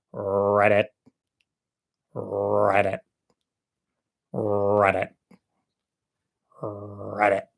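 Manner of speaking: low, drawn-out croaking "read it"; frog croak. Acted. A frog on a lily pad croaks at the chicken. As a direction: croak it like a bullfrog, slow.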